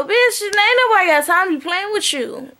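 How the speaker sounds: high-pitched, indignant telling-off; sassy scolding. Real, not acted. Vlogger telling off Kenya over her show, sassy scolding.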